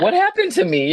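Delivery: high, loud mock-panicked question; acted alarm. Patient reenacting waking constrained in bed, alarmed and bewildered. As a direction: cry it out in exaggerated bewilderment.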